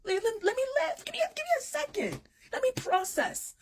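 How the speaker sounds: squeaky, very high stammered begging; hammed-up high voice pleading for space; exasperated plea. Acted plea to be given time.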